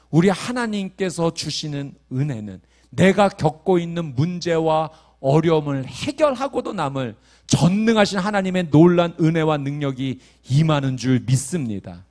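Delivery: usual speaking voice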